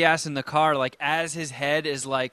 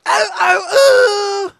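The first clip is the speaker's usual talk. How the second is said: very high, loud wailing shriek; grape lady shriek; 9 dB louder, much slower than usual. Retold or imitated. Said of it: "the grape lady going".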